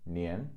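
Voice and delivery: rising Mandarin tone; nian in second tone. Shown as a demonstration. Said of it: "second tone".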